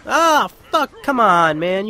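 real outburst of annoyance, loud, starting high; frustrated yell. Real, not acted. Player cursing a mishap, frustrated yell. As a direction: shout in exasperation at the game.

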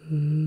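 a low short closed-mouth "Mm" hum. Shown as a demonstration. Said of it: "Here's the second humming noise".

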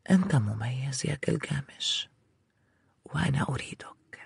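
seductive whisper. Acted. Ishtar seduces Gilgamesh.

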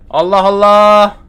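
slow, frustrated Allah Allah; exasperated drawl. Real, not acted. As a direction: stretch it out in disbelief. Can't find the gasoline.

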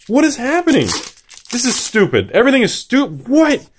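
high-pitched, flustered outburst; exasperated disbelief. Real, not acted. Opener overwhelmed by cards everywhere, high exasperated outburst.

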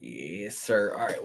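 usual speaking voice

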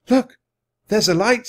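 high, loud exclamation; excited discovery. A narrator's reading. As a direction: call out a sudden sighting. Annie spots a light.

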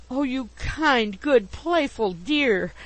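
slow, high, effusive endearment; lavish each word on the beloved; adoring gush. A narrator's reading. Widow about to fling her arms round Pickwick, adoring gush. Bardell gushes before embracing him.

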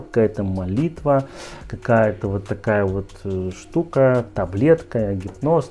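ordinary talk

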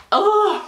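high, loud lovestruck groan; overwhelmed fangirl ugh; swooning groan. Real, not acted. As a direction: melt out loud. Melting at his smile.

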